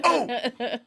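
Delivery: high-pitched mock "oh!"; sudden realization. Joey remembers he needs his passport, sudden realization. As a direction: imitate a penny suddenly dropping, high.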